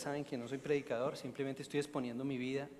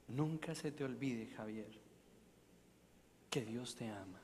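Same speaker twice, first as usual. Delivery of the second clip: softer, gentle reassurance; tender; 3 semitones lower, 8 dB quieter than usual. Retold or imitated. Lino reassuring the weeping young man, soft and tender. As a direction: say it softly like a grandfather comforting.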